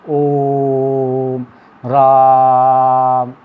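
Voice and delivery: slow chanted ओम राम, vowels stretched; long-held vowel. Shown as a demonstration. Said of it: "इनके बोलने में सबसे ज़्यादा समय लगता है".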